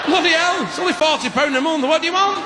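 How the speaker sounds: high-pitched, fed-up outburst; acted exasperation. Fed-up explainer to a demanding customer, exasperated. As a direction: lose patience and let the pitch shoot up.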